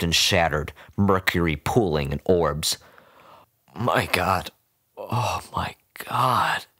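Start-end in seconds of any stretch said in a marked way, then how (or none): 3.7-6.7 whimpering. A narrator's reading. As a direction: breathe it out in shock. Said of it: "the vet whimpered".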